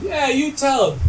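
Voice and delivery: high-pitched mock call from above; retold yell. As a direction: imitate a bystander calling down his support. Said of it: "stop and just yell down".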